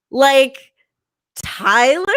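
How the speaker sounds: loud, high disbelieving question; incredulous. Real, not acted. Comedian mocking the name mom picked, loud and incredulous. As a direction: scoff at the name Tyler. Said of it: "insulted that she thought".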